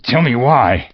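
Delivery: frightened Scrooge; anxious demand. Acted. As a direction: press the ghost for an answer.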